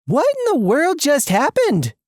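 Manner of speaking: high-pitched astonished question; acted shock, pitch leaping up; bewildered. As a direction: demand an explanation in shocked disbelief. Bewildered after the sudden jailbreak.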